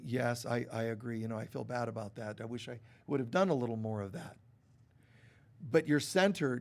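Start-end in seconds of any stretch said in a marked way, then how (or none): 0.0-4.4 softer, lower, gentle apology; soft and sincere owning up to the kids; tactful apology. Retold or imitated. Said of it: "you have to be very tactful".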